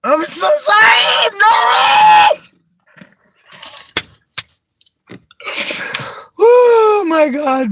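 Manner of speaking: high, loud, apologising through laughter; can barely get it out for laughing; laughing apology. Real, not acted. Apologising to Nora while laughing hard, high and loud.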